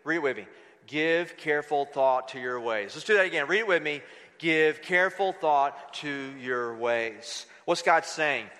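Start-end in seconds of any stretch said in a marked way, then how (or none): 0.9-3.0 low, measured scripture reading; solemn read-along. Acted. Leading congregation in reading scripture aloud. 4.4-7.5 slow, low, solemn scripture reading; slow read-along. Acted. Repeats verse for congregation to join.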